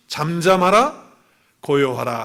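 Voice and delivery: loud, drawn-out order to the storm; firm, slow rebuke; rebuking command. Acted. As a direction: command the wind with authority. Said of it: "바람을 꾸짖으시면서".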